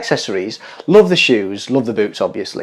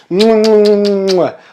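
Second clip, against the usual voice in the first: blown kisses; 4 semitones higher, slower than usual. Acted. Blowing kisses to camera.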